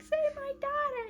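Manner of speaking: high-pitched mock wail; squeaky mock desperation; desperate plea. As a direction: plead like a frantic mother, hammed up. Distraught mother pleading.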